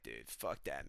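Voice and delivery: low, soft put-on fighter voice; Nate Diaz. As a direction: imitate him in an undertone. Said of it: "Nate Diaz is like".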